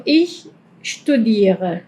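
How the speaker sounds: German ich studiere; slow ich studiere. Shown as a demonstration. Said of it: "cuando yo digo yo estudio".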